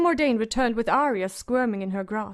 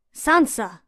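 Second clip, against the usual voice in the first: calling sharply; 6 dB louder than usual. A narrator's reading. Septa raps at the door.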